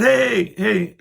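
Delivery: calling out. Retold or imitated. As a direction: call after her as she leaves. Calling out to neighbor.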